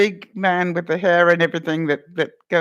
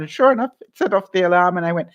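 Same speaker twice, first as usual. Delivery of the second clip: usual speaking voice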